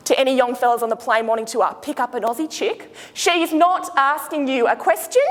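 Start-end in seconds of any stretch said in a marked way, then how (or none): 3.1-5.2 slow statement that lifts on "question" like a query; rising statement ending. Shown as a demonstration. Shazza teases tourists about Aussie women, "question" rising like a query. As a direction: stretch it out and tip "question" upward. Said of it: "go up at the end of our sentences".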